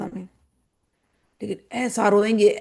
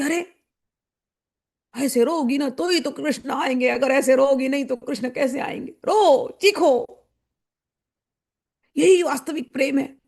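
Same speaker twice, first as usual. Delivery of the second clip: mock urging devotee; 3 semitones higher than usual. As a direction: egg the others on to wail. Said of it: "दूसरों को उकसाएंगे".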